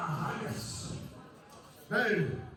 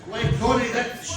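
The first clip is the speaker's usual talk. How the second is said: much louder, slow half-sung declamation; bellowed verse; 7 semitones higher, 12 dB louder, much slower than usual. Acted. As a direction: belt the lines out to the room. Bellowed, half-sung poem lines.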